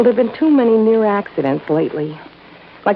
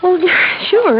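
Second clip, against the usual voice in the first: laughs it off, pitch jumping higher; a quick uneasy laugh to cover the lie; nervous laugh; 9 semitones higher, 3 dB louder than usual. Acted. Penny laughing off Johnny's question about the rifle, nervously.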